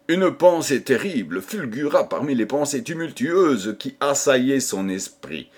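ordinary delivery

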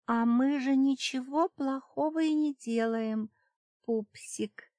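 high cooing seductress voice; breathy purring seductress. A narrator's reading. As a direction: coo it high and teasing. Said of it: "проворковала суккуба".

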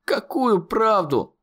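high, slowed desperate cry; desperate wail. A narrator's reading. Conor wailing at the monster in panic. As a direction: howl it while struggling. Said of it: "завопил Конор".

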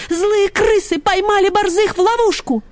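high, urgent report; out of breath. A narrator's reading. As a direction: blurt out the alarm in a high voice. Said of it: "задыхаясь от бега, отрапортовала Ксюня".

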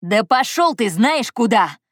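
loud, high, drawn-out curse; furious retort. A narrator's reading. Anton curses Andrey in a quarrel, furious. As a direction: send him packing, slow and loud.

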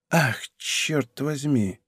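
soft, slow, drawn-out curse; hushed dismay. A narrator's reading. Aramis learning his plan was seen, hushed dismay. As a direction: curse under your breath. Aramis alarmed at bad news.

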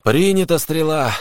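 a touch of doom in the acknowledgement; doomed exhale. A narrator's reading. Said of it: "обречённо выдохнул Суджук". (обречённый ответ, высоким голосом)

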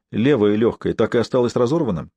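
ordinary delivery

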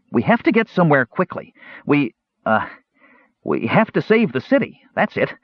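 high, hurried kender covering something up; nervous kender voice. A narrator's reading. Kender voice, evasive.

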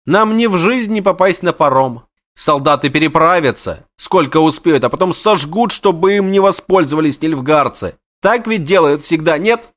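high, fast, panicky chatter; jittery poet begging his friend; frantic poet. Acted. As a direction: babble in a panic to flee. Voiced poet character.

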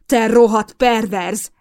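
loud, slow furious insult; slow enraged shout. A narrator's reading. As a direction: spit the insult in rage. Mason attacking the intruder.

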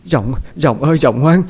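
put-on a grandmother begging a dead child to wake, wailing; sobbing. A narrator's reading. Grandmother wailing over her dead grandson, crying. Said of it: "khóc nức nở".

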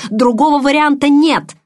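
громкий высокий яростный крик; рявкни, обрывая спор; рёв. A narrator's reading. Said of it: "заревел он". (яростно заревев)

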